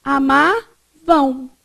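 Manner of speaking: "Amavam" drawn out slowly, ending in a nasal u glide; slow demo. Shown as a demonstration. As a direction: stretch "Amavam" and let it close to a nasal u. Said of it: "mas ele tem som de U". ("Amavam" bem devagar, final nasal em u)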